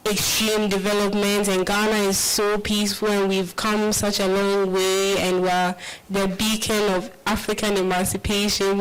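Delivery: usual speaking voice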